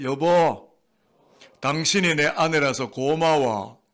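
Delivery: slow, drawn-out declaration of affection; affectionate, not dry. Shown as a demonstration. Preacher coaching husbands to thank their wives, affectionate, not dry. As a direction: stretch it out with feeling for others to echo. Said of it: "드라이하게 이야기하세요?".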